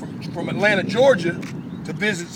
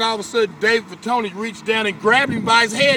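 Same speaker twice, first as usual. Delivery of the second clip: normal talking voice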